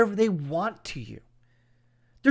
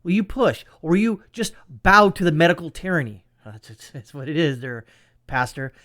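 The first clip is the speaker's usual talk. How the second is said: usual speaking voice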